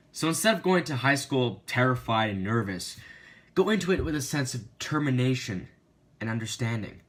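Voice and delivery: loud, punchy script delivery; presenter read. Acted. Reading the script aloud.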